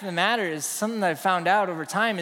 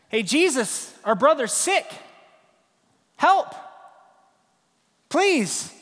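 higher, urgent plea rising on help; pleading sisters; 6 semitones higher, slower than usual. Retold or imitated. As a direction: beg for your sick brother. Sisters begging Jesus for help.

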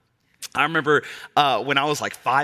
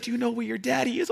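higher, softer and faster lady voice; kind Disney lady; 10 semitones higher, 4 dB quieter, faster than usual. Retold or imitated. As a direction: ask sweetly, a little hurried. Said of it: "And she's like".

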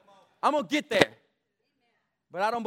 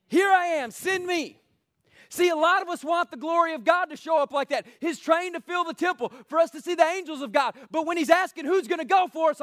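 raised, emphatic sermon voice; forceful preaching; 9 semitones higher, 5 dB louder than usual. Real, not acted. Preacher challenging those who want the glory of God, forceful preaching. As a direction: preach it high and insistent.

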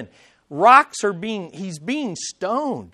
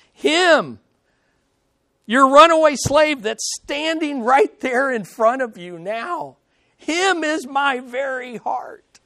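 him pitched higher and louder, my very heart slowed; emphatic reading; 4 semitones higher, 4 dB louder than usual. Acted. Paul declaring to Philemon that the slave is his heart, emphatic. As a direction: land each word of my very heart slowly. Paul's letter handed to Philemon.

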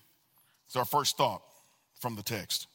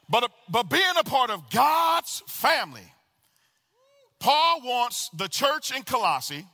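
higher, driving sermon delivery; impassioned preaching; 5 semitones higher, 3 dB louder than usual. Real, not acted. Preacher telling the church that Jesus is first, impassioned. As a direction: preach it with rising fervour and punched key words.

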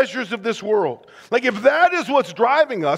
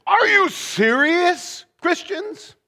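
higher, slow, pointed disbelief; Are you serious, said high and slow in mock disbelief; incredulous challenge; 8 semitones higher, slower than usual. Shown as a demonstration. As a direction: demand it in disbelief, drawn out. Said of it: "it would probably be translated like this".

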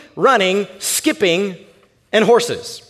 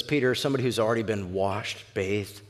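low, soft correction; hushed, steady reassurance; patient Jesus; 10 semitones lower, 11 dB quieter than usual. Acted. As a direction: explain it quietly and evenly. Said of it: "Jesus looks at him and says".

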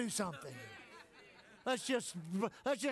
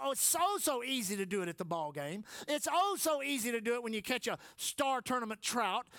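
ordinary delivery

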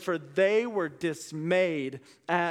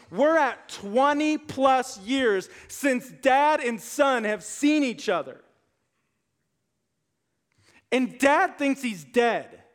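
louder, slowed, punching the years; raised-voice sermon emphasis; emphatic preaching; 4 semitones higher, 4 dB louder, slower than usual. Real, not acted. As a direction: drive home the long separation. Stressing two decades apart.

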